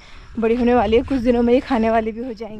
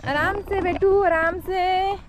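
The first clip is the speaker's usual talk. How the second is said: gentle caution; 7 semitones higher, slower than usual. Real, not acted.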